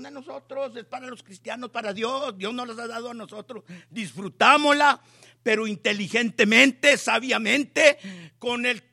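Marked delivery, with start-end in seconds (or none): 3.9-8.8 louder, fervent call to enjoy wisely; preaching at full force. Real, not acted. Preacher urging the church, loud and joyful. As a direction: proclaim it with a laughing shout.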